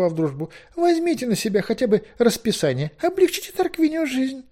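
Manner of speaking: high, loud, fussy and hesitant figure; high-pitched flustered rambling with little hems; agitated Merlin. A narrator's reading. Merlin pleads with colleagues to help overloaded Zmeik, agitated.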